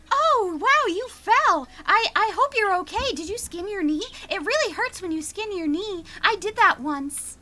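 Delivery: put-on high, eager little boy; sugary child, overly helpful; sweet kid voice. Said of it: "he's like".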